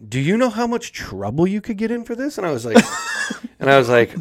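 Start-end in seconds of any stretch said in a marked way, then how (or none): 0.1-2.4 high-pitched, incredulous scolding question; mock alarm, pitched up; retold alarm. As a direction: imitate a wide-eyed coworker scolding. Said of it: "looks at me with huge eyes".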